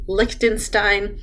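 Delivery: ordinary talk, nothing marked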